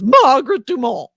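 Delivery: put-on high, loud old-movie dame; Margaret Dumont. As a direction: sing out the name like a grande dame. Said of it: "persona comes from".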